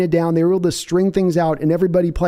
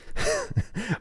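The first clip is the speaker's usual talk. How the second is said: laugh; 11 dB quieter than usual. Real, not acted. Laughs after accent bit.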